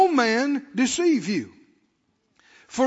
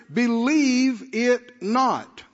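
"believe it not" stretched out, each word stressed; "believe it not" in slow, weighty, separate beats; slow emphatic command; 3 dB louder, much slower than usual. Acted. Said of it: "Can you see the emphasis".

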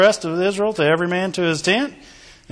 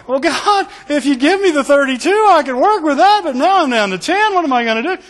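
mock-worried plea to God, pitched higher and louder; doubting believer; 9 semitones higher, 3 dB louder than usual. Preacher mocking a doubter's plea to God, high and fretful. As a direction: play the anxious doubter who thinks ten men are not enough. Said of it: "Me and you'd be going".

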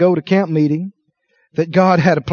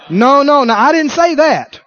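firm denial; 6 semitones higher, 4 dB louder than usual. Acted. Acted firm denial to questioner.

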